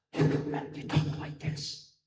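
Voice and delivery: slow, breathless whisper with no voice; parched whisper. Shown as a demonstration. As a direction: let only air come through, slowly. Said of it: "all you get is a whisper".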